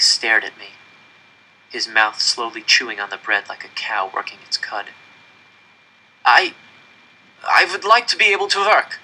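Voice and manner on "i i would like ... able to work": high, faltering pleading voice. A narrator's reading. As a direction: stammer out the plea. Boy pleads with Moonface.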